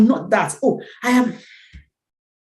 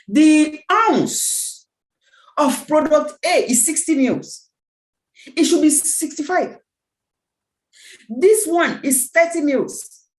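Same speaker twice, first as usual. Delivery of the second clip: ordinary talk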